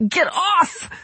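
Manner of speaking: sudden, very high cry; high-pitched, impulsive outburst; blurted protest. A narrator's reading. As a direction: burst out with it to fend someone off. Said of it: "she blurted".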